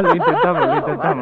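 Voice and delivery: giggling through the words; laughing. Real, not acted. Risa respondiendo a broma. (riendo a carcajadas)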